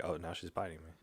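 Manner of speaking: ordinary talk, nothing marked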